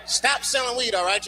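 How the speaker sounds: usual speaking voice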